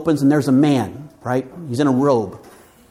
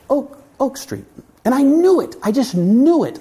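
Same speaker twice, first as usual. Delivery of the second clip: higher, louder joyful certainty; triumphant; 9 semitones higher, 3 dB louder than usual. Real, not acted. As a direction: crow about being right. Triumph on finding street.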